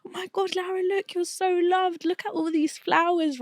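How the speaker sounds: high, thrilled grandma gushing, first words breathed; delighted grandma. Retold or imitated. Grandma showing Lara her flowers, high delighted gush.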